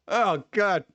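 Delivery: ordinary talk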